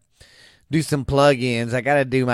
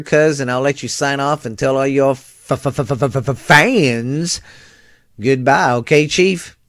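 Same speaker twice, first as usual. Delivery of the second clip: normal talking voice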